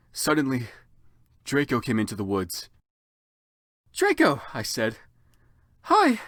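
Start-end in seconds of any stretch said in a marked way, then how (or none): 3.9-4.4 put-on girly greeting. Heroine greeting boyfriend in character. 5.8-6.2 put-on girly hi. Heroine greeting in falsetto.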